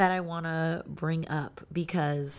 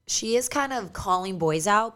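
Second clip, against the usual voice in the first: ordinary talk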